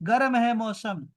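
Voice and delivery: ordinary delivery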